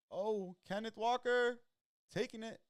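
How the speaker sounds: high, loud, slow excited call. Real, not acted. Streamer yelling as a running back breaks free, high loud call. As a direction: call the run like a thrilled fan.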